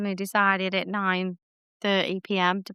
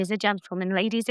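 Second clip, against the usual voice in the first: ordinary talk, nothing marked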